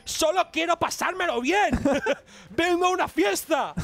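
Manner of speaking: high-pitched playful impression; Mizi. Caster imagines a monster wanting fun, mock high-pitched plea. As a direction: put on a high character voice, hammed up for laughs. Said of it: "con la voz de Mizi".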